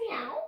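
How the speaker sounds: put-on rising little meow for the kids; a quick, curious cat meow; playful meow. Teacher showing cat pose to the class, rising meow.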